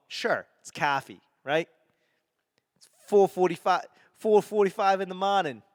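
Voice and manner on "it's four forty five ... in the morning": higher-pitched Boston accent. Shown as a demonstration. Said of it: "get away from my accent".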